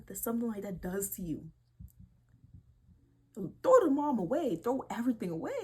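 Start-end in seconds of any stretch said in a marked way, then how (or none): 3.3-5.5 louder outraged command. Real, not acted. Outraged at the cheating mom, loud command. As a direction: throw it out in outrage.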